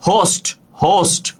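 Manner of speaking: "হোস্ট" repeated with an audible h; teacher's clear model of "হোস্ট", starting on h; H pronounced. Shown as a demonstration. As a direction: sound the opening h of "হোস্ট" clearly. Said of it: "হ উচ্চারিত হয়েছে".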